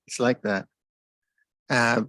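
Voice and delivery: normal talking voice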